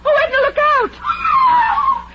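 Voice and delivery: long, high, slow shriek of warning; terrified scream. Acted. Kay screams a warning to Edna as the stone flies.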